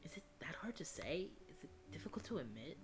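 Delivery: soft, half-whispered coaxing; gentle hushed question. Acted. Character coaxing out a hard admission, gentle hushed question. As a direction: ask it softly and gently.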